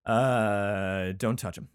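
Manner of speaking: ordinary talk, nothing marked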